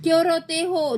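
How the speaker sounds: high-pitched mock yell; shouted question. A narrator's reading. Raat Rani to the crying children, shouting. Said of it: "चिल्लाकर".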